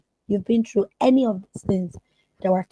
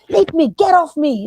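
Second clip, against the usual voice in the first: high, loud yell to leave her alone; imitating a frightened, angry outburst; angry shout; 9 semitones higher, 8 dB louder than usual. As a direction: snap at whoever touched her. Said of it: "she goes".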